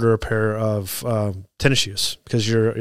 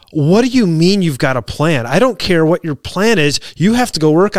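raised, heated scolding; lost it; 4 semitones higher, 4 dB louder than usual. Retold or imitated. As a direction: chew him out, louder and higher. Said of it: "I lost it on this guy".

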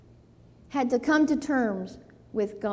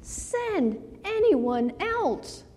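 slow, higher, drawn-out begging; reluctant plea; 8 semitones higher, 3 dB quieter, much slower than usual. Retold or imitated. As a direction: stretch it out like a man refusing his call. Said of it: "listen to what he said".

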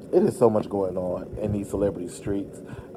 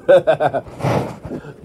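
real bright laugh; giggling at herself; 6 semitones higher, 8 dB louder than usual. Real, not acted. Laughs at own fussing.

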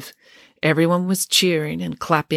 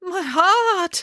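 high, hammed-up cry of pain; faked heart attack. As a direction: clutch the chest and cry out. Dame Bronwyn fakes heart attack.

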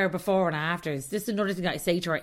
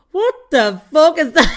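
loud, high, laughing outburst; mock shock; 8 semitones higher, 10 dB louder than usual. Host reacting to her face on the front camera, loud laughing shock. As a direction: blurt it out, horrified and laughing.

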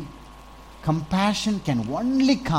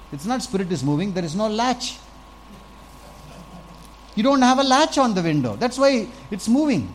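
rising exasperation; 7 semitones higher than usual. Real, not acted. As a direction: push up from mocking to exasperated, driving the point home. Mocking superstitious believers.

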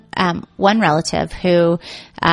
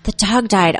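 ordinary delivery

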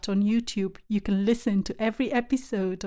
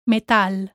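Metal as meh-TAL; stress on A; 3 dB louder than usual. Shown as a demonstration. Teacher models for learners, Metal stressed on the A. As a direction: punch the A in Metal, Spanish style. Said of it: "stress falls on the A".

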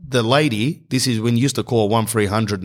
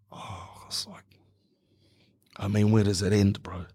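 hushed, near-whispered dismay; stunned quiet; 7 dB quieter than usual. Acted. Student taking in the failed result, hushed dismay. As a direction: drop to a very soft, breath-only murmur at first.

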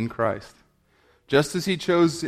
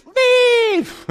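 very high, loud mock shriek; tantruming little boy; 7 dB louder than usual. Little boy on the floor answers mom, shrieked mock tantrum. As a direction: squeal it out like a kid on the floor. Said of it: "lays down on the floor and says".

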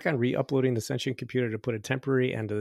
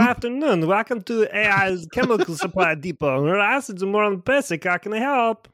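higher, louder New York shopkeeper; New York accent; 9 semitones higher, 7 dB louder than usual. Pretend. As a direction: sell it like a brash New York clerk. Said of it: "I'm from New York, can't you tell?".

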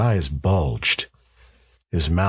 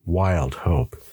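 usual speaking voice

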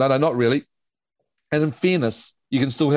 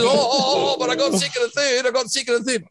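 high, whiny gloating coach; mocking coach voice; 10 semitones higher than usual. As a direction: squeal it like a smug coach. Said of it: "but the coach says".

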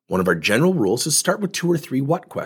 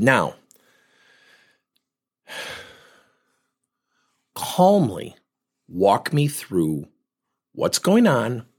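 very slow, soothing coach; slow it right down to calm him; much slower than usual. Shown as a demonstration. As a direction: coach calming an upset man. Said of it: "opposite insertion question, "Walk me through calmly".

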